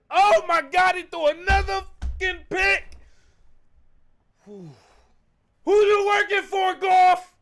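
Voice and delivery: loud, high, furious yelling; furious shout. Real, not acted. Fan screaming at his quarterback after a pick, furious shout. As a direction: scream at the screen in rage.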